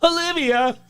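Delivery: a sudden high shriek of the name, way up; high scream. Retold or imitated. Friend shrieking his sister's name in the woods, high scream. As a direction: scream her name in panic. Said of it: "he was screaming".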